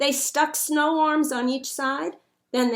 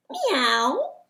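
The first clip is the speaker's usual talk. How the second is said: a cat's meow, louder; 4 semitones higher, 4 dB louder than usual. A narrator's reading. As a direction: meow like a cat. Cat character meows.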